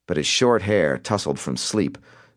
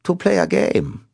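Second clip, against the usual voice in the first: put-on high, drawn-out European-accented taunt; Simon, European accent; 14 semitones higher than usual. A narrator's reading. Caller tells Cobb what he wants, European accent. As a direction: tease it out slowly, European accent, pitched up. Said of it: "recited in a European accent".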